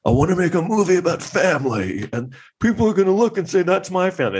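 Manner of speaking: high, earnest hammed-up pitch; mock Coppola. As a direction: parody a director's grand pitch. Quoting Coppola in biopic.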